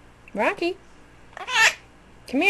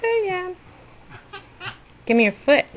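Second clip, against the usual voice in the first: usual speaking voice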